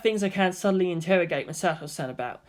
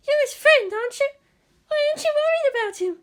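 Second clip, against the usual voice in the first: much higher, pleading schoolgirl voice; Hikari upset. Acted. Hikari scolding Kensuke for not worrying, high and upset. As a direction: demand an answer, near tears.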